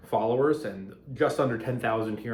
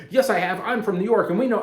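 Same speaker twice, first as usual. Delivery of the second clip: loud and much higher, defending New York pride; mock indignant retort; 9 semitones higher, 6 dB louder than usual. Commenter from New York hitting back, loud mock indignation. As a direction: fire back like an offended New Yorker. Acted comment section fight.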